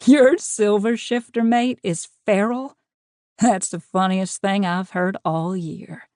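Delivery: put-on loud, incredulous Southern accent, slowing down; mock them in a Southern drawl; Southern-accented amused disbelief. A narrator's reading. Jet amused, mocking in drawl.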